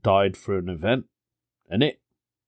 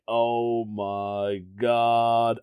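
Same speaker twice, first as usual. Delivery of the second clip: drawn-out mock panic; slow-motion dread; much slower than usual. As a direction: stretch the words as if time has slowed. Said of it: "Everything slowed down".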